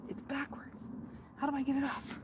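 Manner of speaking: soft nervous whisper; hushed panic. Real, not acted. Whispering, fumbling in secret.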